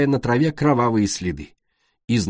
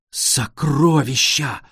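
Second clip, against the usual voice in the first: slow, awed gasp of treasure; excited exclaim; 3 semitones higher, much slower than usual. A narrator's reading. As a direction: marvel at the riches. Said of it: "воскликнула Цунами".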